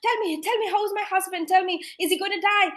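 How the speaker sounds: high, pleading voice of a frightened wife; frantic wife. Retold or imitated. Anxious wife begging the doctor about her dying husband, high and frantic. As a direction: beg the doctor for news, fast and high. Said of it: "They'll be like".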